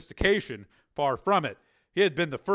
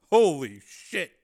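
slow, loud, awed curse; floored by what he sees; stunned exclamation; 3 semitones higher, 8 dB louder, much slower than usual. A narrator's reading. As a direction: draw it out in amazement. Catches breath at the sight.

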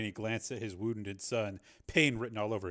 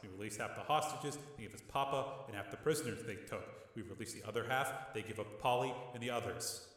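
softer, level calm reasoning; calm reasoning brother; 8 dB quieter than usual. A narrator's reading. Rick reasoning with his hothead brother, quiet and calm. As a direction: talk him down quietly.

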